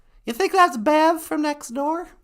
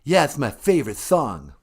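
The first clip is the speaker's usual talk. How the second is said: prank caller pretending to be a rock star; 13 semitones lower than usual. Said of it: "It's me, [name] of Kiss".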